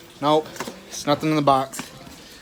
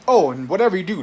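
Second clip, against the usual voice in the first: usual speaking voice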